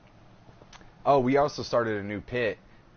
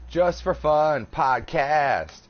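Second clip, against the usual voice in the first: slow, louder announcer read of the name; show announcer; 4 semitones higher, 4 dB louder, much slower than usual. Acted. Host announcing the podcast title, slow and grand. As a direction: stretch it out like a radio ident.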